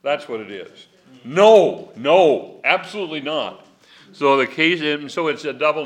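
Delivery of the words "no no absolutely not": loud, high emphatic no; emphatic negation, loud and firm. Shown as a demonstration. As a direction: slam the door with no, no. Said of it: "it's called emphatic negation".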